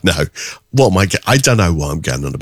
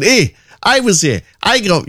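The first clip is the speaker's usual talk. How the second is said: bad Scottish accent. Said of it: "I can't do a Scottish accent".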